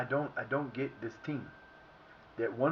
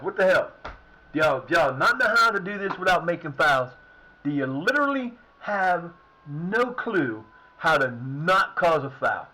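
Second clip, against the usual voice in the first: 1 angry rant; 3 semitones higher, 6 dB louder than usual. Real, not acted. Ranting at his players.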